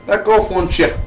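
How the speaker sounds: mock telling-off with an Irish lilt; slightly Irish accent. Reviewer fending off critics, accidentally sounding a little Irish. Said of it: "Oh, I sound a little Irish there".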